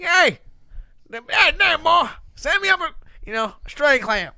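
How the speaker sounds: put-on loud, high Southern country accent. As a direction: call up the line in a country accent. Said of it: "like, a country accent".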